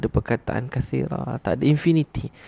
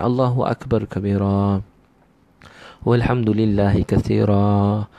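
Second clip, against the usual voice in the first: slow, drawn-out Arabic recitation; prayer recitation; slower than usual. Shown as a demonstration. Preacher shows what we recite in prayer, slow recitation. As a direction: recite it slowly, stretching the final syllables. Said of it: "Contoh kita semayang".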